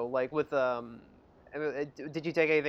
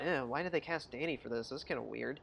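much softer doubtful musing; inner thought; 4 semitones lower, 13 dB quieter than usual. Retold or imitated. Recalling a private puzzled thought, much softer. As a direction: mutter it to yourself, puzzled. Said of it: "So I thought".

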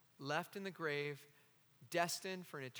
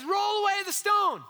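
higher, louder ringing command to roll away the stone; raised command; 12 dB louder than usual. Acted. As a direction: proclaim it like a command. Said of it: "he says".

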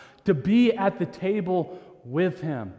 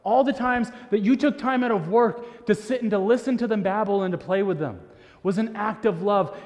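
ordinary delivery

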